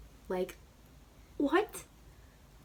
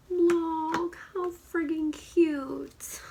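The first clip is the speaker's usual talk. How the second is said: gushing; 8 semitones higher, 5 dB louder, much slower than usual. Real, not acted. Gasps at the product.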